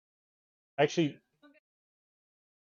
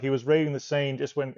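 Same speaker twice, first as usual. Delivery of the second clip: usual speaking voice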